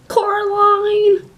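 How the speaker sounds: loud, high, drawn-out call. Acted. Other Mother costume, calling for Coraline, high drawn-out call. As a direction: sing the name out loudly, stretched.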